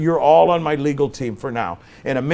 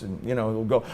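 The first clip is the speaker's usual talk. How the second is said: ordinary talk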